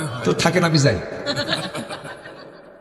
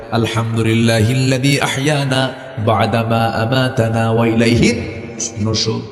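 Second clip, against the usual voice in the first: slow recitation; 5 semitones lower, slower than usual. Real, not acted. Recites dua.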